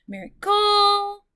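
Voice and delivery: Miracle slow, ending cle pitched high and loud; stress on last syllable. Shown as a demonstration. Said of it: "See how funny that sounds?".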